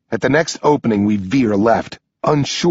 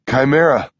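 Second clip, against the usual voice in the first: usual speaking voice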